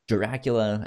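ordinary talk

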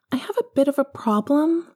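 put-on high, bright child asking a favour; young boy asking to watch TV; little boy voice. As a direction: pitch up into a small boy. Young Harry confesses a problem.

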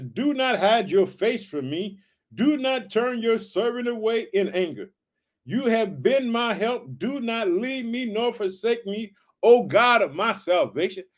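slow, lifted scripture reading. Acted. Preacher reading the psalm to his family, slow and lifted. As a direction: read the verse out with weight. Reads verse nine aloud.

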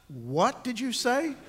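mock-outraged wife, stretched out; indignant wife.

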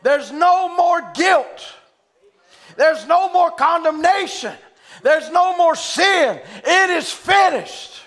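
loud, high, slow preacher's cry; rising revival preaching; preaching at full force. Acted. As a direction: proclaim it to the congregation. Preaching about release from guilt.

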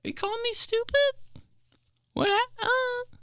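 very high, soft squeaky voice; mock offended. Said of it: "I'm like".

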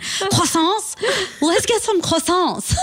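high, slow mock French accent; hammed-up French, relishing the word croissants; thick French accent. Imitating Ryan's mom suggesting croissants, thick high French accent. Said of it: "then she has the thickest French accent".

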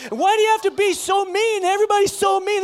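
high, loud mock wail; imitating sobbing woman. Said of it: "and she said".

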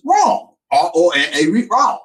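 high-pitched imitation of a Southern kid; Deep South kid, name swallowed to one syllable; Deep South accent. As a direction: spell it out in mock Louisiana accent. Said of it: "Fantastic accent".

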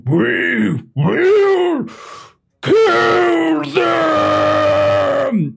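loud, high war cry; a booming war cry that echoes across the battlefield; battle yell. A narrator's reading. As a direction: roar it out to rally the whole army. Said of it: "as he just yells".

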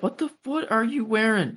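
a high, lightly voiced baffled father; a hint of an exasperated father questioning his daughter; mock stern dad. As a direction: ask it like a dad shocked at his daughter's outfit. Dad scolding daughter's outfit.

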